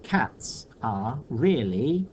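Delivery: "Cats are really and playful" slow, with a pause; measured read-out. Shown as a demonstration. Teacher testing the line without a word, slow measured read-out of "Cats are really and playful". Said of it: "the line would read".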